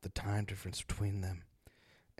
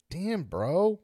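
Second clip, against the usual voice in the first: high, loud surprised outburst; 10 semitones higher, 7 dB louder than usual. Real, not acted. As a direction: blurt it out amazed. Reacts to story.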